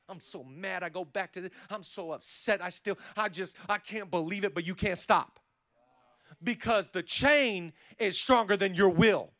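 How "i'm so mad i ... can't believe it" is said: mad and upset. Acted. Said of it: "bondage that makes you angry".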